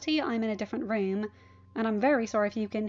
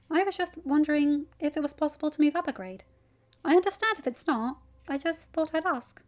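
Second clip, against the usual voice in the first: a hint of an earnest teenage boy, higher; lightly voiced teen Peter; 5 semitones higher, 4 dB louder than usual. A narrator's reading. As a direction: Peter pleading his case, earnestly.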